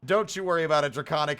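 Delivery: usual speaking voice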